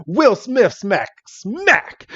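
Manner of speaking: high, playful mock battle cry; comic attack call. Mewtwo answers the slash with a slap, comically gleeful.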